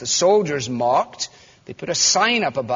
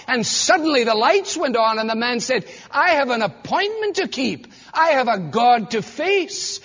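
fervent preaching; 6 semitones higher than usual. Acted. Preacher voicing Jesus and criminal.

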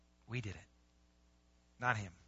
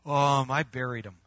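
slow, lower, hesitant confession; sheepish admission; 4 semitones lower than usual. Acted. Servant owning up to the master, sheepish. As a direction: drag out the awkward answer.

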